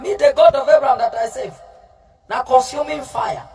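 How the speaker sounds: ordinary talk, nothing marked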